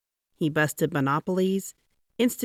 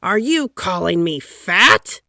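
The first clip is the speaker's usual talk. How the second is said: offended demand; 3 semitones higher, 8 dB louder than usual. A narrator's reading. Taft offended.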